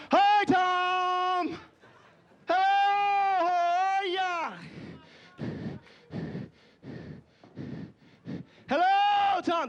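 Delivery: high, long drawn-out singsong hello; imitated bear man greeting. Bear man greets Tom the bear, high calling voice. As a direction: call out high, stretching each word.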